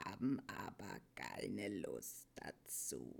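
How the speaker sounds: low, very soft, listless creature; grunting old Morla. A narrator's reading. Morla refuses to tell Atreyu, grunting low and uninterested. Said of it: "grunzte die Morla".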